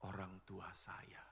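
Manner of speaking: slow unvoiced whisper; whispered grief. Priest voicing a grieving parishioner, slow whisper. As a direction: let the words fall away in a whisper.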